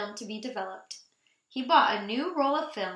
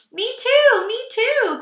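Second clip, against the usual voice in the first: high, loud and pleading; eager cry; 13 semitones higher, 11 dB louder, slower than usual. Acted. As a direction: beg for a turn, bouncing. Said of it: "cried Moose and Rabbit".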